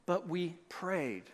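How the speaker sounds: slow, soft reverent reading; Nehemiah's words quoted slowly and softly; solemn quote. Retold or imitated. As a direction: let it land slowly and quietly. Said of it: "when he says this".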